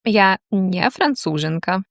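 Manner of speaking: normal talking voice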